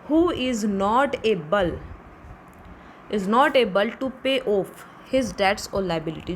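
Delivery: very slow, word by word, as if for notes; slow dictation. Shown as a demonstration. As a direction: dictate each word so students can write. Teacher dictating a definition.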